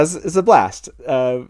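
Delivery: ordinary talk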